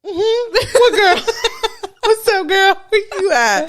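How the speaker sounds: very high, loud, laughing disbelief; shrill giggling mock call. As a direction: call out for the invisible girl through laughter. Family mocking sleepwalker's ghost girl.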